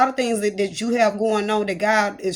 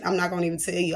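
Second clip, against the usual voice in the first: normal talking voice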